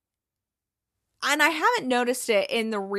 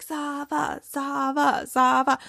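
imitated cheering; 3 semitones higher, slower than usual. The boys greeting Zava as he walks in, imitated cheering. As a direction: mimic a crowd cheering his name. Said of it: "thinking that everyone's cheering".

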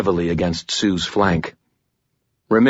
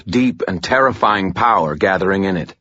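ordinary delivery, nothing marked

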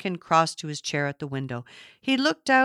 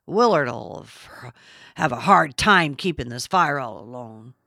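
put-on bragging old man; 5 semitones lower, 3 dB louder than usual. A narrator's reading. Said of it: "Duncan bragged".

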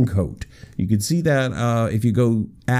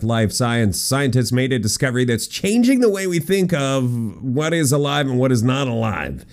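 ordinary talk, nothing marked

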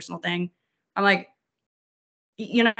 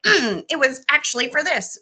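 high-pitched pedantic correction; nitpicking commenter; 7 semitones higher, 4 dB louder than usual. Acted. Imagined Instagram commenter correcting the podcast, nitpicking voice. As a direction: clear the throat, then correct primly.

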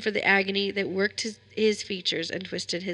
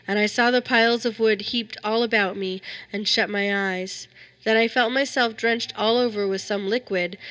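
ordinary delivery

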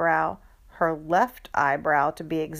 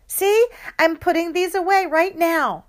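eager show-off; 11 semitones higher, 6 dB louder than usual. Acted. Kid showing off cleanup.

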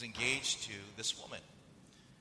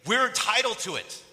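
emphatic claim; 9 semitones higher, 11 dB louder than usual. Acted. Preacher's climax quoting a claim.

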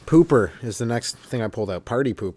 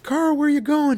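much higher, whiny mock friend voice. Party friends stopping Carl leaving, high whine. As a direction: call after him in a high whine.

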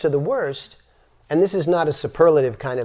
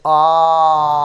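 loud open ah, throat wide; 6 dB louder than usual. Shown as a demonstration. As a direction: push the breath out through an open throat. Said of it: "And then you do this".

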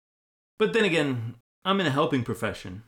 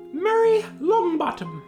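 high, loud, slow call; 7 dB louder, slower than usual. Acted. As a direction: call the name across the hall. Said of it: "Professor McGonagall called out".

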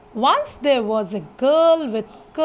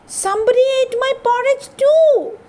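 high, quick, put-on mother bear voice; Mama Bear; 10 semitones higher, 4 dB louder, faster than usual. Mama Bear to her family, finding her porridge eaten, upset. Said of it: "said Mama Bear".

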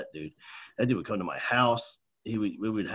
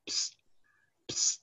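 secretive "psst" to get attention; hissed psst. Retold or imitated. Band director beckoning a seventh grader from the classroom door, hissed psst. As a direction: imitate a sneaky psst from the doorway. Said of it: "and I hear this".

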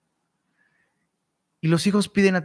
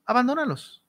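Abandónalos said higher and mocking; higher ironic repeat; 7 semitones higher, 4 dB quieter than usual. Shown as a demonstration. Preacher mocking careless parents, higher repeat. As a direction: throw Abandónalos back, higher, mock advice. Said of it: "Quieres provocarlos a ira".

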